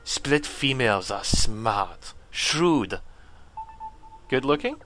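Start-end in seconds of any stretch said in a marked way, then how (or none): 0.0-3.0 painful character voice. Acted. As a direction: put on a strained warrior voice, deliberately paced. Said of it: "the most painful voice for him".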